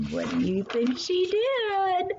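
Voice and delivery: high, slow, teasing question; playful question to listeners. A narrator's reading. Storyteller asking young listeners, high and slow teasing. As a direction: draw the children into guessing.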